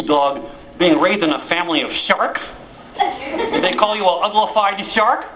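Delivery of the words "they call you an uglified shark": normal talking voice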